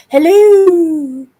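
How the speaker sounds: loud, high excited hello; excited greeting. Real, not acted. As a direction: call out a happy hello. Greeting someone arriving.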